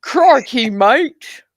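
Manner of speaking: imitating a rival's put-on Australian accent; mocking Australian accent. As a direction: sneer out an Australian catchphrase with a lightly mocking accent. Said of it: "He puts on the weird accent".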